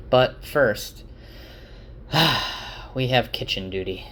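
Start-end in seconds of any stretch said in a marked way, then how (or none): 1.6-2.9 long unvoiced sigh of dread; a put-upon sigh before the bad news; weary sigh. Real, not acted. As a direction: sigh at the chore ahead. Groaning about kitchen duty punishment.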